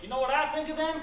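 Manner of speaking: very high, loud acted question; mock provocative outburst. Imagined outburst at a protest.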